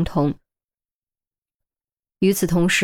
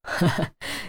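short, soft, breathy laugh; amused chuckle; 8 dB quieter than usual. A narrator's reading. Director laughs at his punched partner, soft chuckle. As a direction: chuckle as the stern act drops. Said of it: "拍腿笑道".

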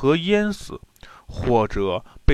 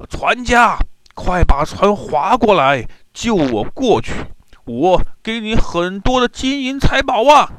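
high, loud call across the water; shouting for help; 8 semitones higher, 5 dB louder than usual. A narrator's reading. Fleeing general shouting to a boatman for rescue, loud high call. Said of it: "兀竹大叫".

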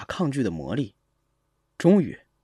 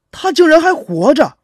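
loud, high cry of disbelief; incredulous, raised voice; shocked exclamation; 10 semitones higher, 10 dB louder than usual. Acted. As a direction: burst out in astonishment. Shocked that classmate still lives.

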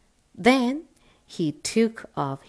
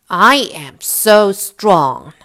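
boastful wind; 9 dB louder, slower than usual. Acted. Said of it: "said the wind".

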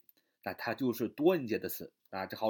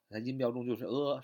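normal talking voice

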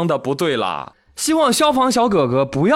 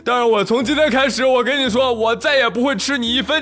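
indignant yell; 8 semitones higher than usual. Retold or imitated. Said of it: "愤愤不平地冲着楼下老爸喊".

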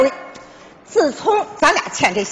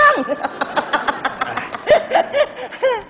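laugh; 6 semitones higher, 5 dB quieter than usual. Real, not acted. Wife laughing at slogan.

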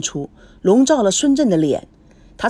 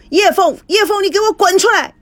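roared much higher, in a fury; loud roar; 11 semitones higher, 3 dB louder than usual. A narrator's reading. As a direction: yell for her to get out here. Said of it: "孙正大吼道". (大声怒吼)

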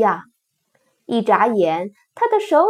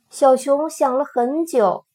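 plain reading voice

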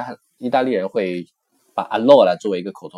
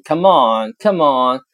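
loud, high Come on come on; teacher showing the English equivalent; urging; 4 semitones higher, 7 dB louder, slower than usual. Shown as a demonstration. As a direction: push them to hurry. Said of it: "相当于英文中".

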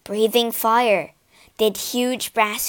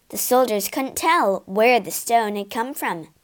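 ordinary delivery, nothing marked

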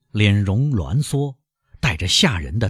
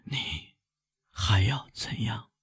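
weak, slow, breathy question; faint, halting, failing breath; gasping; 8 dB quieter, slower than usual. A narrator's reading. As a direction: barely get the words out. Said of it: "喘息似乎要撕破他的喉咙".

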